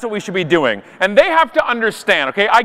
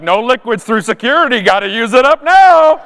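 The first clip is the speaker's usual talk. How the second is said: louder, rising shout at the end; mock security call; 2 semitones higher, 5 dB louder than usual. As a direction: imitate a guard calling out to a line. Imitating security's loud announcement.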